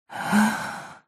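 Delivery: soft, breathy, high sigh. Acted. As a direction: let out a soft airy breath. Sigh between lines before battle.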